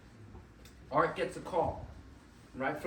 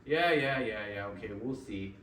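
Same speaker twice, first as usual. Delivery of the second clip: dismissive retold brush-off, dropping low; a little annoyed; 6 semitones lower than usual. Art brushes off Walt Disney's vision, a little annoyed. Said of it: "he's a little annoyed".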